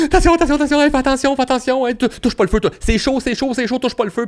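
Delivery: high, loud, frantic repeated warnings; panicked parent warning. Retold or imitated. Parent yelling at toddler near the stove, frantic and loud. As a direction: shriek warnings at a child near fire. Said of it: "ont le réflexe de".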